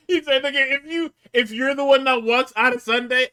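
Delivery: high, loud, just after cracking up; laughing retelling. Real, not acted. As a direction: retell the threat through laughter.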